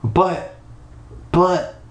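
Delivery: high, halting "But, but,"; stammering. Acted. Startled kid to ghost boy, stammered "But, but,". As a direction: stammer "But," with a pause, then repeat it high. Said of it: "I stammered".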